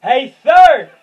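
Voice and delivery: high, loud mocking shout; hammed-up yelled taunt. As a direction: bark it out, slow and hammed-up. Quoting someone, big loud voice.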